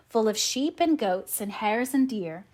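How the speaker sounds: usual speaking voice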